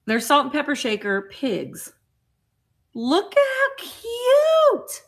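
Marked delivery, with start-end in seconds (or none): 2.9-5.0 very high, drawn-out squeal of delight; gushing squeal. Real, not acted. Showing tiny pig shakers, gushing squeal. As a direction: squeal over something adorable.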